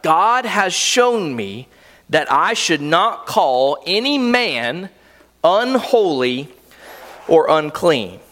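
slow, weighted, with a pause before unholy and another before or unclean; slow emphatic reading. Shown as a demonstration. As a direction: let each word land slowly. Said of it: "Think about that".

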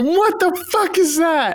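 squeaky outraged Vine quote; Vine impression. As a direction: bark it like the Vine dad. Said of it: "the fucking Vine where he's like".

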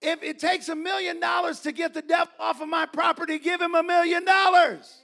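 high, loud decree building to a shout; thundering command. Retold or imitated. The Lord answering Doctor Schuler, thundering command. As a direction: boom it out as God's order.

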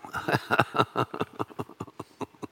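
long breathy chuckle in quick pulses; laughing. Real, not acted. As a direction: let a long wheezy laugh run out. Preacher's own laugh between remarks.

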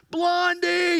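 high imitated yell of a name; mock yell. Preacher mimics Tuco yelling from the noose, mock yell. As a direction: call out like a man hanging from a noose. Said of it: "Tuco's yelling from this".

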